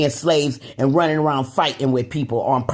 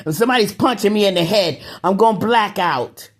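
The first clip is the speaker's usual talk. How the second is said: ordinary talk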